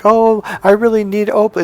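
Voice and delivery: high, put-on begging villager; mock crying plea. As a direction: whine the plea as an imitation. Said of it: "they would cry".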